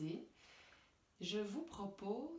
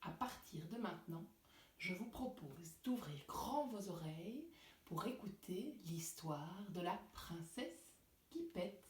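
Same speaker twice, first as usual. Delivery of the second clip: put-on storytelling for kids; 3 semitones higher, 3 dB louder than usual. A narrator's reading. Story narration to children.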